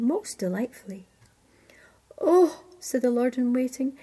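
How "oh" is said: high, loud "oh" of wonder; amazed exclamation. Acted. Lord in waiting hears the nightingale sing, amazed. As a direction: react with sudden admiration.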